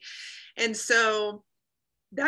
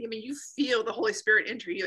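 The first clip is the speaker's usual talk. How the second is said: ordinary talk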